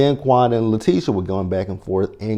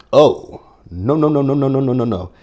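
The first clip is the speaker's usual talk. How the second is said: louder, higher string of no's; rising oh no; 4 semitones higher, 5 dB louder than usual. Real, not acted. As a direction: pile up the no's in disbelief. Reacting to shocking claim.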